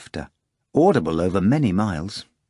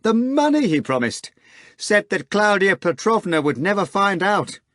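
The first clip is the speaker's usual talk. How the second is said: screaming, laughing; 10 semitones higher than usual. Acted. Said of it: "Natasha screamed, laughing".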